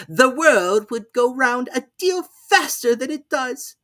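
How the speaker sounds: loud growl, high, mock hoarse; Duchess's hoarse growl. A narrator's reading. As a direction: snarl it grandly. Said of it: "in a hoarse growl".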